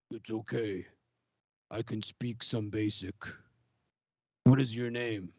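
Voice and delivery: dignified Wookiee. Acted. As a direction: speak carefully like a proud beast learning a language. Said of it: "in a very dignified manner and in kind of broken basic".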